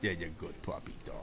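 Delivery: quiet, tender praise for the pup; soft praise. Real, not acted. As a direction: murmur it gently to the dog. Praising the dog afterwards.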